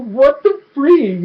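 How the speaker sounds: high, drawn-out mock shock; baffled disbelief. Fan reacting to a villain's return, baffled. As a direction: stretch it out in astonishment.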